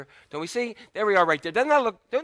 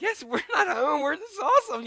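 mock gushing; 9 semitones higher than usual. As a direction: play the overjoyed parent, stretched out. Parents gushing at the pool.

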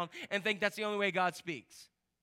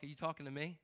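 sarcastic; 5 semitones lower, 3 dB quieter, faster than usual. Acted. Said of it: "He kinda gives that talk".

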